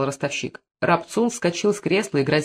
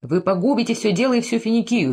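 higher, urgent interjection; moderately raised alarmed voice; 6 semitones higher than usual. A narrator's reading. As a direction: break in with pitch lifted in warning. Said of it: "повышая голос".

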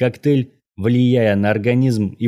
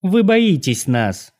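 высокий, медленный девичий голос с презрением; цеди слова свысока, повыше и нараспев; надменный тон; 9 semitones higher, slower than usual. A narrator's reading. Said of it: "произнесла она надменным тоном". (надменно, с презрением)

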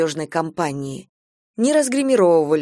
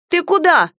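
high, louder woman's query; startled question; 11 semitones higher, 6 dB louder than usual. A narrator's reading. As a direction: ask in sudden alarm, pitched way up. Lena questions man rushing away. (удивлённо, высоким голосом)

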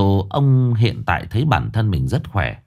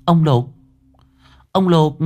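calling his name, high and alarmed; anxious calling; 7 semitones higher than usual. Acted. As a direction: call out to the husband in a panic. Wife finds husband collapsed, calling. (gọi chồng hốt hoảng)

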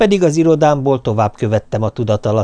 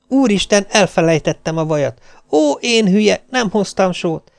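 higher, exasperated outburst at herself; flustered self-scolding; 7 semitones higher than usual. Retold or imitated. Wife scolds herself over forgotten shopping, higher and flustered. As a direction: berate yourself for forgetting the butter.

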